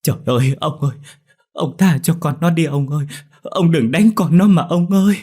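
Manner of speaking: high, loud begging for mercy; distraught mother wailing her appeal; desperate pleading. A narrator's reading. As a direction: plead frantically for your child. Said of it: "đoạn van xin".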